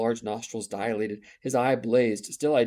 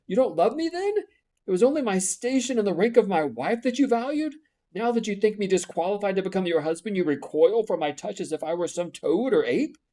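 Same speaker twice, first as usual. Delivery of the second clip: higher, louder wounded reproach; hurt accusation, voice pitched up; bitter sadness; 9 semitones higher, 6 dB louder than usual. Acted. Rochester reproaching Jane, higher and wounded. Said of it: "accent of bitter sadness".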